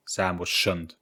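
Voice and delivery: usual speaking voice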